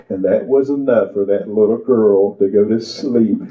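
usual speaking voice

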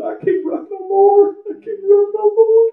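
high, breaking voice of surrender; tearful pleading. Retold or imitated. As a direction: cry it out like a worn-out sinner giving up. Preacher reliving tearful surrender prayer.

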